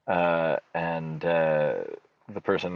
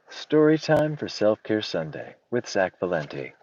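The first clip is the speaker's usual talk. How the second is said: normal talking voice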